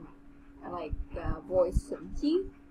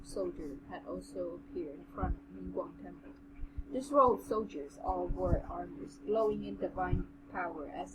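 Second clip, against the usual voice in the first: measured, even audiobook read; story narration; 6 dB quieter than usual. A narrator's reading. Narrator reading a fantasy novel to the co-host, story narration. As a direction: read the tale aloud with composure.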